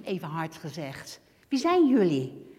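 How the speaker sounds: ordinary talk, nothing marked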